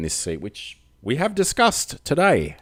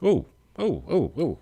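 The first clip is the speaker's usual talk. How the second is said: surprised; 6 semitones higher than usual. Real, not acted. Surprised by wrong button.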